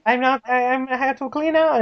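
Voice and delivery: high-pitched mock Spanish accent. Woman posing as cleaner, Spanish accent. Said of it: "like, a, a Spanish accent".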